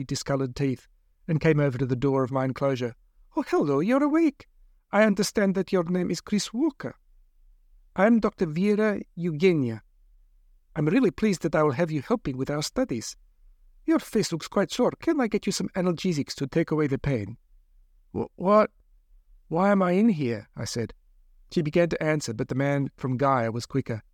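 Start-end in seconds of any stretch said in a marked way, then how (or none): 3.4-17.4 bright, overly friendly doctor, higher; sweet as pie, clinical underneath; cheerful doctor voice. Acted. Doctor greets the captive patient. 18.1-20.5 confused. Acted. Narrator wakes confused in cage.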